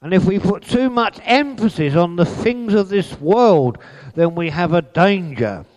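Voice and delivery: high, loud pulpit delivery; preaching. Acted. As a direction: preach it out with fire. Preaching at full force.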